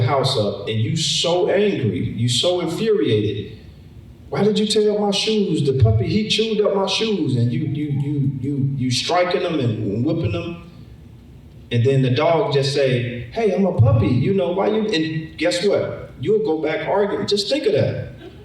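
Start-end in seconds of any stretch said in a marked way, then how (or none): 4.3-7.4 high, raised scolding of a puppy; angry pet owner. Retold or imitated. Owner scolding the puppy for the chewed shoes, angry and high. 13.3-14.9 high, a bit louder, innocent puppy. Retold or imitated. Puppy talking back to its angry owner, high and innocent.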